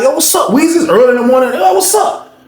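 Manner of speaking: bright, high call; high greeting voice. Retold or imitated. Homeboy greeting him in the morning, high call. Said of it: "my homeboy pull up like".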